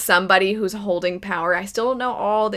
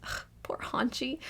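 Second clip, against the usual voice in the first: whispered pity; 5 semitones higher, 13 dB quieter than usual. Real, not acted.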